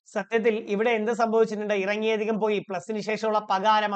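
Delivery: ordinary talk, nothing marked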